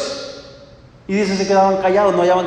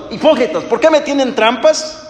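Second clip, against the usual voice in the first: indignant rebuke; 7 semitones higher than usual. Acted. Jesus rebukes the Pharisees, high and indignant. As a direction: call them out, drawing out each hipócritas. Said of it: "Jesús conociendo los corazones".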